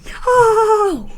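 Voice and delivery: high, loud cry of "No!"; protesting wail. Real, not acted. Kid objecting to his laptop being traded in, protesting wail.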